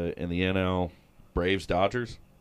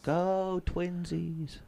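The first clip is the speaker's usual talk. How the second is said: silly voice; 6 semitones higher, 5 dB quieter, much slower than usual. Pretend. Mocking cheer in silly voice.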